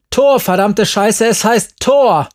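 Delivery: high, loud cursing outburst; angry scream. A narrator's reading. Crying Jerry corrects the narrator's word, angry scream. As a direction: yell the correction furiously. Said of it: "schrie sie".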